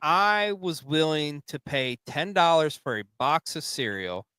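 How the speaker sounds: ordinary delivery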